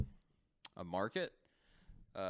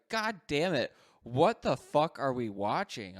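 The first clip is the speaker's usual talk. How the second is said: loud, slow mock exasperation; retold exasperation; 2 semitones higher, 6 dB louder, slower than usual. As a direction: imitate a fed-up partner, stretching the question. Retelling Chelsea's second, exasperated reaction.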